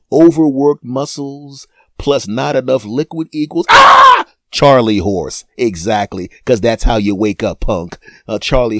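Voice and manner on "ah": pain yell. Acted. Mock pain cry.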